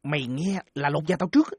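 low hushed warning; whispered aside, half-joking fear; conspiratorial murmur. Acted. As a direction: keep it secret from mom. Secretive warning about the mother.

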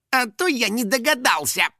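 high-pitched mock realisation; sarcastic. Finik retorting to the elder, sarcastic. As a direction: fling it back sarcastically, high. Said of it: "язвительно переспросил домовой".

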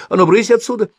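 strict nurse's sharp order; scolding shout. A narrator's reading. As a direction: shoo him off high and loud. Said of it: "прикрикнула на Тимошку". (строгий высокий окрик)

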